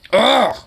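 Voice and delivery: loud high disgusted ugh; frustrated groan. Real, not acted. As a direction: let out a fed-up yell of disgust. Frustrated outburst.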